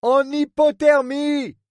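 high, slow, carrying shout; shouting from the deck. Acted. Captain shouting to the quay about the injured man. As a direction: call it out over the wind.

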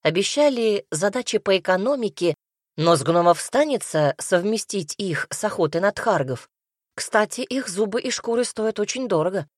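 usual speaking voice

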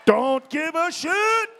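slow, loud, shouted defiance; defiant shout. Acted. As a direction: yell it out slowly at the crowd. Comic repeating, shouted.

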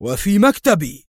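indignant at insult in his own office; angry outburst. A narrator's reading. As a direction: governor angered at fight in his office, loud outcry. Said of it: "قال الحاكم غاضبا".

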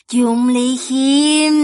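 loud, slow, drawn-out call; taunting villainess. A narrator's reading. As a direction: stretch the name out menacingly and loudly. Villainess calls out her target.

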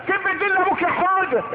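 put-on high-pitched goading of a small child; egging-on parent. Parent tells Houda to curse his father, egging on.

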